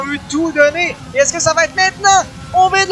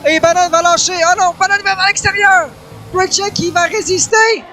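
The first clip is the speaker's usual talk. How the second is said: excited, higher race call; motorsport commentator; 6 semitones higher than usual. Real, not acted. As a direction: call the fight wheel to wheel. Live play-by-play climax. (commentateur sportif excité)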